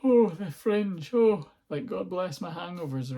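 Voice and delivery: slow, high, wistful sigh over the Fringe; mock-sentimental sigh; mock solemn nostalgia. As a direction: ham up a teary old-timer's lament. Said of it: "I'm not looking down, by the way, in, like, a solemn way".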